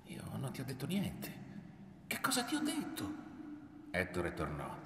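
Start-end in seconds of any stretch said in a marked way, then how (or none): 0.1-3.1 soft, high-pitched protest of innocence; hurt, higher-voiced self-defence; defensive protest. A narrator's reading. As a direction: plead that you did nothing. Mother protests her innocence to son.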